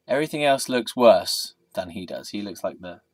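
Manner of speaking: ordinary talk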